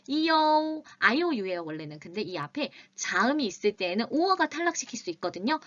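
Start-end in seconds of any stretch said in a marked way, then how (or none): 0.1-0.8 이오 said as yo, clearly; 이오 glided together; 이오 modeled clearly; iu sound. Shown as a demonstration. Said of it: "발생했습니다".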